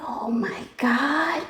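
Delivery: slow shocked whisper; stunned hushed oh my God. Retold or imitated. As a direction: breathe it out in disbelief. Shocked at the detectives.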